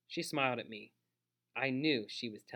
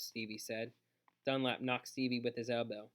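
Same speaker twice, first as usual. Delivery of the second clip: ordinary delivery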